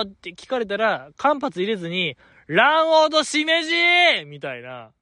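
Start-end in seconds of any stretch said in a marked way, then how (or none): 2.5-3.7 high, slower mock yell of random words; blurted shout. Answering the classmate's question with a random shouted blurt. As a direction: blurt it out at once, pitched high. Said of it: "頭に浮かんだなんか単語を叫んだ".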